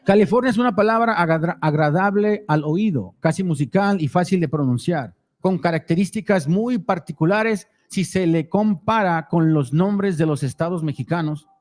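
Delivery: reading the Spanish text aloud; steady Spanish read-through; reading foreign language. Real, not acted. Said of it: "read it in Spanish".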